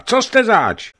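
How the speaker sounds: high, loud demand; a boy calling out at an intruder; loud, high alarmed question; loud challenge. Acted. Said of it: "zvolal Jegorka".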